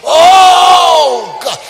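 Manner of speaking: very high, very loud wail; re-enacted cry of religious ecstasy; ecstatic holler. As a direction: holler it out, stretching the first word. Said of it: "started speaking in tongues and just".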